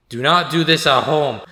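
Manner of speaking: loud mock safety warning. Real, not acted. Jokey safety warning to viewers.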